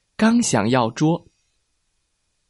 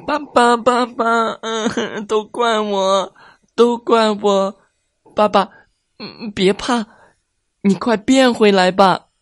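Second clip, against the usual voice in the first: put-on crying child; 9 semitones higher than usual. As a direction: beg through tears for dad to change back. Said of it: "卡卡大哭道".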